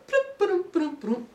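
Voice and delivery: high mimicked plops of rain; high bloop ba-dum noises; raindrop sounds. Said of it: "Not raining like".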